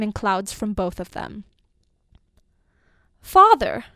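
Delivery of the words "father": loud, high call to father; child's cry. A narrator's reading. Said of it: "cried one child".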